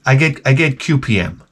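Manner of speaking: ordinary delivery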